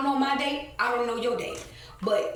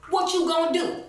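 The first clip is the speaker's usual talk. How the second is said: high, louder sneering taunt, imitating the worker; mocking taunt; 8 semitones higher, 4 dB louder than usual. Mimicking the worker's taunt to her with high mockery. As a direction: throw his challenge back in a mocking voice. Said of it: "and say, "Oh, you telling?".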